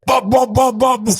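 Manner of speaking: high mock bub noises; mock babble. Host mimicking noise-drowned speech while recording. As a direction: sputter bub bub like a flustered guy. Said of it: "I was like".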